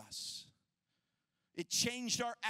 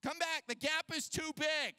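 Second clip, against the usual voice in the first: wife calling them back, higher; mock worried yell; retold yelling; 8 semitones higher than usual. Wife yelling at them to come back, in a mild mock yell. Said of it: "She's yelling".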